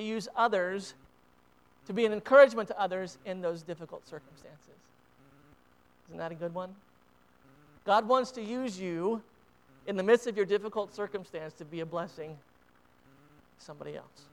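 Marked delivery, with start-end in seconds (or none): none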